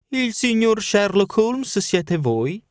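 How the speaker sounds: put-on high, slow, ironic old woman's question; old housekeeper. Old housekeeper greeting Holmes on the steps, high and ironic. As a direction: peer at him like a sly old maid.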